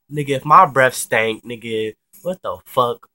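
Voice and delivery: loud mock-outraged comeback; indignant retort. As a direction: fire back, pitch and volume up. Retort to insult about breath.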